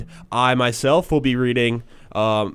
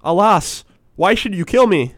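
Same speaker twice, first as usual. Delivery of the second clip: high, louder frightened plea; frightened cry; 8 semitones higher, 4 dB louder than usual. Acted. Fisherman pleads with the genie, high frightened cry. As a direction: beg for your life, high and loud. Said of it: "cried the fisherman".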